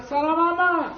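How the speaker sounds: high, loud hello to a beloved aunt; delighted greeting. Acted. Man greets his aunt on the phone, delighted. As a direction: greet her with a happy, lifted call.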